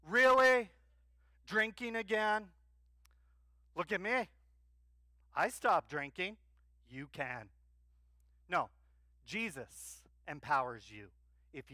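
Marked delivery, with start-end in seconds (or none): 0.1-7.5 mocking self-righteous. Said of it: "they go".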